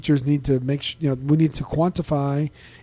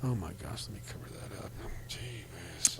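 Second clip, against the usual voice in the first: much softer, low, to herself; soft embarrassed aside off mic; muttered aside; 8 semitones lower, 19 dB quieter than usual. Real, not acted. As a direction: drop to a hushed mutter. Aside while covering the light.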